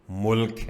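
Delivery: ملک said as "mulk", short u, one syllable; mulk, country. Shown as a demonstration. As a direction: say ملک with u after m. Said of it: "country".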